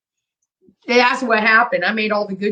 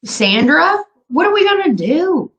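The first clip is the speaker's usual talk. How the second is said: exasperated calling out; 9 semitones higher, 5 dB louder than usual. Real, not acted. Calling out to a chatter.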